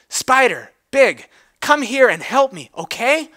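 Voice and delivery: high, loud urgent call. Acted. Twilight calls Big Mac to come help her, high urgent call.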